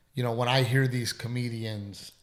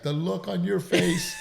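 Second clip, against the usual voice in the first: laughing; 6 semitones higher than usual. Retold or imitated. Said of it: "busted out laughing 'cause he's like".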